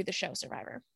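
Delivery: fast, whispered question. Real, not acted. Host asks the guest if she would do Survivor, in a fast whisper. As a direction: whisper it quickly, almost secretly.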